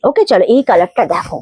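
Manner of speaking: ordinary talk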